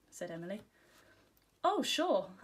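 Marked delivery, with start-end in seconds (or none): 1.6-2.3 high, louder, too-ready agreement; quick, hasty assent. A narrator's reading. Girl hiding worry from friends, quick assent. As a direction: agree hastily to cover your unease. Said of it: "I said quickly".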